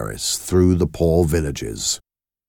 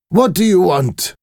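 impatient; 13 semitones higher, 4 dB louder than usual. Acted. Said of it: "the Marquis asked impatiently".

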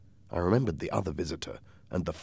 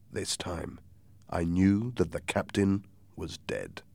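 ordinary delivery, nothing marked

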